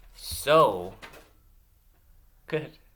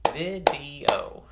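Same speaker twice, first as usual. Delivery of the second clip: VIDEO louder, beat out syllable by syllable; clapped syllables; 2 semitones higher, 5 dB louder than usual. Shown as a demonstration. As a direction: punch each syllable of "Video" separately. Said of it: "clap them out".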